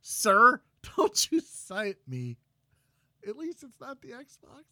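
high, loud, slow mock scolding; hammed-up offended scold; mock indignant. Mock scolding co-host for sighing.

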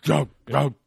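suddenly loud, mock-pleading don't; loud mock protest. Repeating the word in mock protest.

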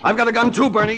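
high, loud challenge called across the room; shouted threat. Acted. Detective shouts to the gangster to draw his fire.

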